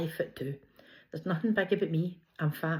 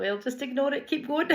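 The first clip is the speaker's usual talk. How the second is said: breezy brush-off; 8 semitones higher than usual. Retold or imitated. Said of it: "And I was like".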